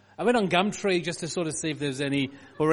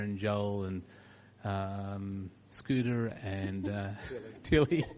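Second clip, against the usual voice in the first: prayer; 4 semitones lower than usual. Acted. Pastor prays over family.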